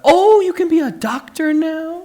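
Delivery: teasing imitation of a thrilled grandmother; delighted grandmother. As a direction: gush over the idea of a doctor. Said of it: "She's like".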